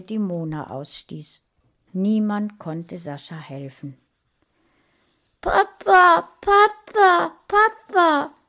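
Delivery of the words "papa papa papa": high, loud repeated cries for dad; calling loudly. A narrator's reading. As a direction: call out desperately for help. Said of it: "rief Sascha laut". (laut und hoch nach Papa rufen)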